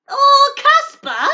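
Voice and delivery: high, loud, stretched-out squeal; delighted squeal. Real, not acted. Reading the goat's name on the soap, a stretched delighted squeal. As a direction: squeal over a cute goat.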